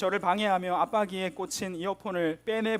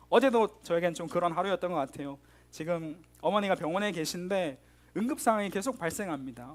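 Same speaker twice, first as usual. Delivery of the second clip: ordinary delivery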